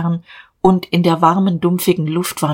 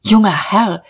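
higher, urgent call; agitated; 4 semitones higher, 4 dB louder than usual. A narrator's reading. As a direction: call after the boss in agitation. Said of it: "er war in großer Aufregung".

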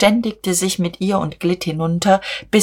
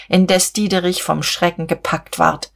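ordinary delivery, nothing marked

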